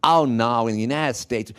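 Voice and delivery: returned Yank's mock American accent; hammed-up American accent from an Irish returnee. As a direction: put on bits of American. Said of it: "bits of an American accent".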